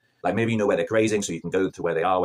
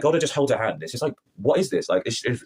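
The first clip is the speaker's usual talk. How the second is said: ordinary delivery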